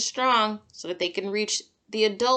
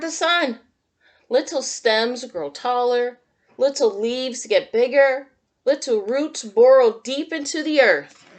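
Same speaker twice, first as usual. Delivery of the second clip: put-on reading to children; 3 semitones higher, 7 dB louder than usual. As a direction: read it like storytime for little kids. Reads picture book to children.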